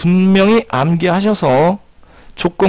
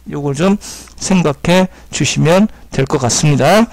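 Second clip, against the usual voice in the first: normal talking voice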